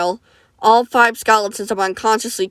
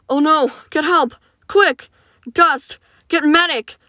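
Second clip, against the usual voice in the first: panicked calls; 7 semitones higher, slower than usual. Acted. Friends panic over broken arm.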